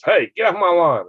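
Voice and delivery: put-on a light cranky old man; mock shout at kids on the lawn; hint of a grouch yelling; grumpy old man.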